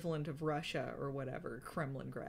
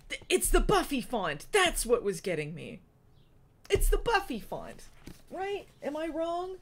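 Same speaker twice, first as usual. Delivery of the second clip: excited; 8 semitones higher, 7 dB louder than usual. Real, not acted. Realizing the font is Buffy's.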